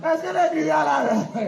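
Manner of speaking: real laughter breaking into a call to the congregation; amused, laughing check-in. Real, not acted. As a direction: laugh through the question.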